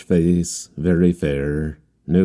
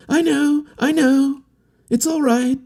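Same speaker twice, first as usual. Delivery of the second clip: much higher put-on voice, called back breezily; a carefree young man shouting reassurance across the water; cheerful yell. A narrator's reading. Harlequin yelling back to the manager, high and cheerful. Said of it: "yelled back the other".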